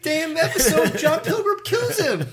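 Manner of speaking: high, loud laughing disbelief. Real, not acted. Laughing while telling outrage.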